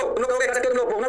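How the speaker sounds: usual speaking voice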